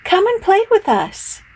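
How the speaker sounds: high playful call. A narrator's reading. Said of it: "they would call".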